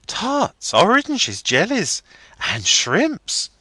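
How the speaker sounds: put-on high, eager girlish list; young girl listing party treats. A narrator's reading. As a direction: tick off each treat with delight.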